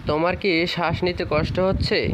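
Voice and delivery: ordinary talk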